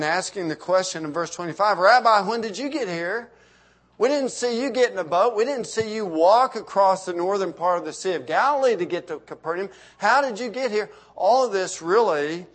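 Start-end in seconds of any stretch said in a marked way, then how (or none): 1.8-10.9 puzzled crowd. Acted. Preacher voicing puzzled crowd's question.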